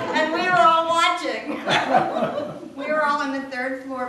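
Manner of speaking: real laughter while remembering; laughing. Real, not acted. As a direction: stretch it out through laughter. Laughing at pony biting story.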